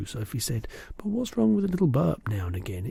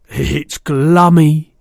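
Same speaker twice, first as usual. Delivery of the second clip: slow, louder savouring; delighted cry; 9 dB louder, much slower than usual. A narrator's reading. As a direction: relish every sound. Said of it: "he cried".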